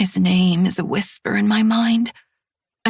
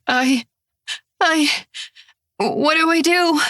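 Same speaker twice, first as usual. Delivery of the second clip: rising panic, voice higher; horrified and lost; panicked stammer; 6 semitones higher than usual. Acted. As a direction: stumble over the words in shock.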